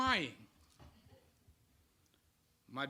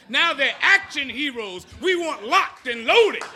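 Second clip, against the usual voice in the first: sarcastic mockery; 6 semitones higher, 10 dB louder than usual. Real, not acted. Mocking political rhetoric.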